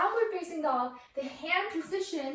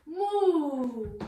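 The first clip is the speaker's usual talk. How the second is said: long drawn-out playful moo; cow moo. Pretend. Teacher answering what the cow says, drawn-out mooing. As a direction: imitate a cow for a toddler. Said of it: "What does the cow say?".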